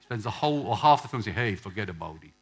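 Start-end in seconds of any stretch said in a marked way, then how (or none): 1.3-2.3 low mock Italian-gangster voice; terrible Italian accent. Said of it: "In terrible Italian accent".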